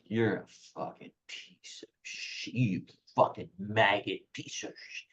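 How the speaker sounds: put-on hushed, whispery voice of a drunk old Southern man; old drunken Southerner. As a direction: imitate an old Southern drunk cursing under his breath. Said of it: "like an old drunken Southern guy".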